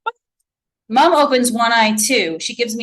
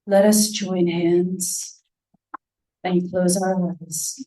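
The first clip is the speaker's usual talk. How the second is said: slow, low and breathy medium; 3 semitones lower, slower than usual. A narrator's reading. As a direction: breathe it out slowly like a tired bear. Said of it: "speaking in this low, breathy voice".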